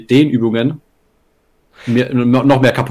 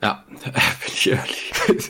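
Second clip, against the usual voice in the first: laughing; 2 semitones higher, 6 dB quieter, slower than usual. Acted. Repeats joke laughing.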